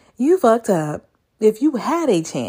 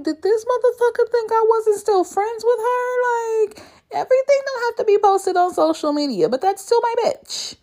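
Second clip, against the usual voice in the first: heated rant; 14 semitones higher than usual. Real, not acted. Ranting about ex matching friend.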